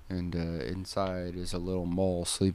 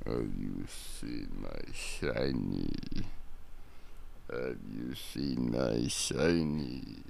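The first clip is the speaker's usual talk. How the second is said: much lower, very slow, hungry creature croon; slow creepy creature; 8 semitones lower, 5 dB quieter, much slower than usual. Shown as a demonstration. Trying out the voice for the story, slow and low. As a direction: drag out the plea like a lurking creature. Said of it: "repeats it slow and low".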